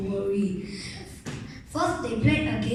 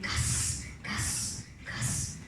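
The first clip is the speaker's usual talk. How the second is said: soft whispered hiss fading away; hissed name; 8 dB quieter, much slower than usual. Acted. Scaly teacher hissing the boy's name in a dream. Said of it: "she hissed".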